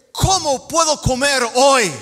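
hammed-up hungry man's shout; desperate cry. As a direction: cry out like someone starving. Voicing a hungry desperate person.

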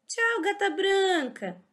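ordinary delivery

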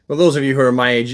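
ordinary delivery

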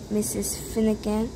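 usual speaking voice

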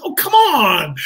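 high, loud, wailing plea; mock pleading protest. Retold plea during a silly door gag, high and wailing. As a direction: draw it out like an imitated whine.

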